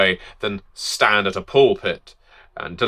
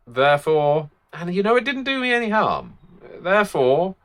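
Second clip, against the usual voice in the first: high-pitched, insistent self-justification; defensive mother; 8 semitones higher, faster than usual. Acted. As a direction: jump up high, with a quick defensive aside. Mother's acted self-justification, breaking off.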